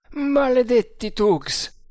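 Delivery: loud, high curse, slowly drawn out; strangled yell. A narrator's reading. Freed captive curses the Tugs, yelling. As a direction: howl the curse as if half choked. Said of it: "urlò Tremal Naik con voce strangolata".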